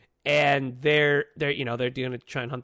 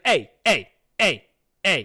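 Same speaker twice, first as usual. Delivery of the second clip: scolding mom; 2 semitones higher, 7 dB louder than usual. Retold or imitated. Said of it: "And she's like".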